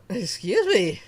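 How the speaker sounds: ordinary talk, nothing marked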